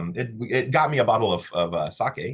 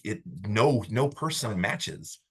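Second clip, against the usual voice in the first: usual speaking voice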